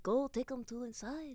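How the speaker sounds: put-on French accent. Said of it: "how to do the accents".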